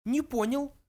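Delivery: high mouse voice, threatening; menacing little mouse. A narrator's reading. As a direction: challenge them sternly in a tiny high voice. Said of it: "грозно сказал Бенджи".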